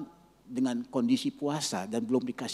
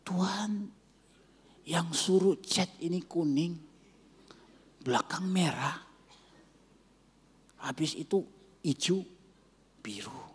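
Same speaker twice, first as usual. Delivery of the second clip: mysterious whisper; 4 semitones higher than usual. Retold or imitated. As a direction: whisper it like a holy secret. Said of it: "kemudian merubah, saudara, suaranya".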